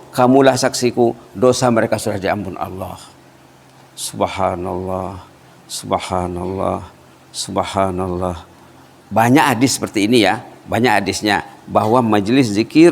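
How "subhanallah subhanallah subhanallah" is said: slow, softer subhanallah, stretched long; reverent softly drawn out. Real, not acted. Preacher praises God before the congregation, slow and hushed. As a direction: let each praise linger in awe. Devout exclamation after the hadith.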